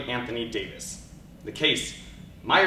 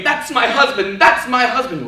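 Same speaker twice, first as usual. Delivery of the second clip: high, loud anguished scream; 10 semitones higher, 9 dB louder than usual. Acted. Wife watching her husband executed, screaming. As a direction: wail it out in horror. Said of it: "the woman scream".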